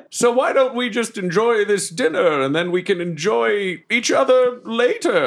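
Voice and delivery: hammed-up seductive character voice; flirtatious theatrical suitor. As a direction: lay the flirtation on with big swoops. Plays Deathwolf flirting.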